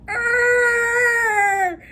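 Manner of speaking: loud, high straining grunt; mock effort growl. Seer grabbing Alara's mind with a loud mock growl. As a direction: strain as she grabs on. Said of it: "and going".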